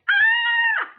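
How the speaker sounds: very high loud scream of fright; mock startled granny shriek.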